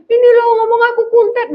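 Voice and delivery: high, quick whiny tattling; crying child. Retold or imitated. Crying child telling the teacher about teasing, whiny. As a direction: imitate a tearful child complaining.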